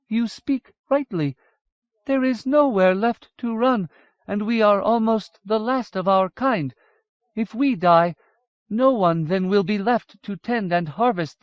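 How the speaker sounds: decide gravely to stand and fight; solemn Dwaro wife. A narrator's reading. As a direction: Dwaro woman accepting her fate. Yssadra voiced high and slow.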